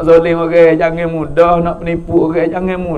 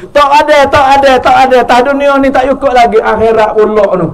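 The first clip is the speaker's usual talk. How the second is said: raised, high preaching voice; emphatic insistence; 5 semitones higher, 5 dB louder than usual. Real, not acted. Preacher telling congregation this world is not enough, emphatic. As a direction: burst out louder and higher, hammering it home.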